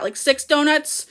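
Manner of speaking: ordinary delivery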